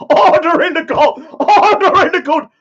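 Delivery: high, loud, laughing cry of order in the court; hammed-up judge yelling for order; a laughing mock gavel call; mock court shout.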